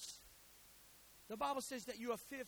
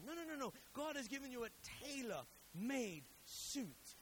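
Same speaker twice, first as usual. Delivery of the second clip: normal talking voice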